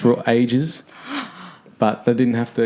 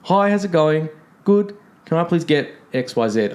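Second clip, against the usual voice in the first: 1 friendly Aussie customer; 5 semitones higher than usual. Retold or imitated.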